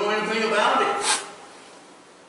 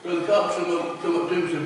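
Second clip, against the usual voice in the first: normal talking voice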